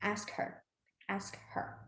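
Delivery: soft unvoiced ask her, fading; whispered quote. Shown as a demonstration. Teacher showing how to sign ask her, whispered. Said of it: "I'll say".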